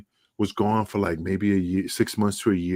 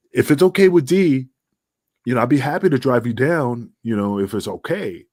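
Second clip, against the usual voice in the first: higher and louder eager offer; 5 semitones higher, 5 dB louder than usual. Retold or imitated. Offering the manager a ride to Philly, higher and eager. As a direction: jump in keen and hopeful.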